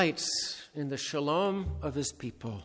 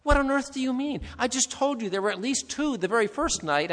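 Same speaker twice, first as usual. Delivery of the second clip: much higher, fast protest; boy objecting to the voice in astonishment; indignant disbelief; 9 semitones higher, 4 dB louder than usual. A narrator's reading.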